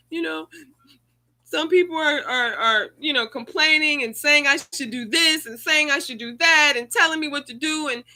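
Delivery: high, loud, breaking into tears; tearful outburst. Retold or imitated. Grieving mother tells pastor, loud and tearful. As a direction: pour out the grief and anger at the critics. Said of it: "I was sitting in the car with the pastor crying".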